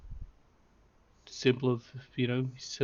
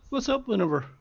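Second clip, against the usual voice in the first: higher, louder casual greeting; bartender voice; 8 semitones higher, 5 dB louder than usual. Acted. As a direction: greet her like a cheery bartender. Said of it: "he comes over and goes".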